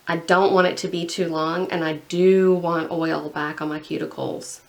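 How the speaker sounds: ordinary talk, nothing marked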